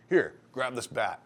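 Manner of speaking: firm commands, acted with force; urgent order. Shop boss arming apprentice against a returning gunman, urgent order.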